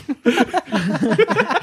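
high-pitched burst of giggles; high giggling burst. Real, not acted. Bursts out laughing.

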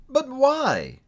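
high, drawn-out question; high-pitched protesting nephew; dismayed cry. A narrator's reading. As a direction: cry out in bewilderment. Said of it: "cried Scrooge's nephew".

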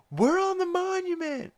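high-pitched mock Lois cry; imitating Lois. Retelling the episode, mimics Lois in a high mock cry. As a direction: squeak it out like Lois clinging to the monument. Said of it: "Lois says".

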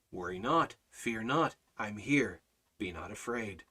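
low, quiet, measured comfort; calm each fear in short soft phrases; reassuring Almighty Father. Acted. God answering a follower's fears, low and reassuring. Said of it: "What does our, our Almighty Father say?".

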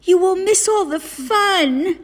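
very high, protesting wail; crying out. A narrator's reading. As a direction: cry out to stop a friend going to bed. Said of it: "cried Frog".

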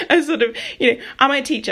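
sudden high, loud burst of laughter; laughing at herself. Real, not acted. Speaker catching herself sounding like a teacher, laughing out loud. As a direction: crack up at how teacherly you sounded.

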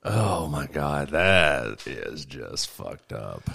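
slow, low, appalled disbelief; disgusted. Real, not acted. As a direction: drag it out, truly appalled. Reacting with disgust to story.

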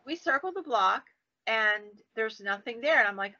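normal talking voice

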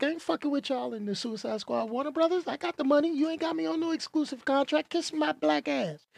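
high, hushed, quick mock refusal; Will Smith. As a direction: mimic a star brushing off the studio, softly. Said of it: "Will Smith was like".

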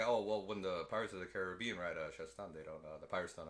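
Ian Malcolm. Acted. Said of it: "And Ian Malcolm's like".

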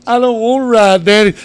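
loud, high-pitched mock kid wail; protesting child. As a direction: imitate a little boy wailing to his dad. Voices his protesting young son.

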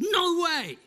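high, loud exclamation; loud emphatic no; firm refusal. Retold or imitated. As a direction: refuse outright. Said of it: "He said".

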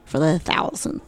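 weary retold correction. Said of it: "I'm like".

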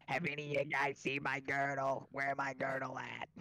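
put-on woman's voice; female voice. Player sends a woman's voice asking the room for her girdle. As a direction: ask for the girdle as a fussy lady. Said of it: "I'm sending a female voice".

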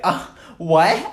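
high, loud mock-confused "uh, what?"; hammed-up bafflement; obnoxious voice. Shown as a demonstration. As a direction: play dumb to annoy the listener. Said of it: "the most obnoxious voice you can".

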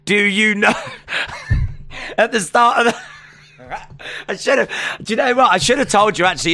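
words squeezed through high laughter; can't stop laughing as the thought hits; laughing hard. Real, not acted. Laughing realization mid-podcast.

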